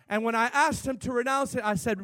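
usual speaking voice

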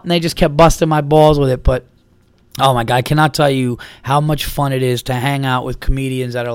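normal talking voice

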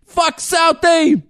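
very high, loud, anguished Boston rant; hammed-up Boston tirade. Bostonian raging over father shot, overwrought mock shout. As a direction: yell it out in broad Boston, near breaking point.

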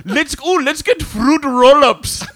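high, loud, slow comic character; excited put-on voice. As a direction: exclaim it in a silly high voice.

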